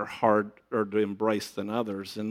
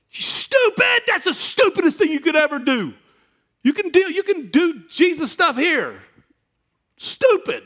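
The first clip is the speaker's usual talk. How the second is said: shouted, much higher and louder mock dad; dad yelling; 8 dB louder than usual. Father yells at his son about going into ministry, high scornful shouting. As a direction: yell it like the furious father, high and loud. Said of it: "When I said to Dad".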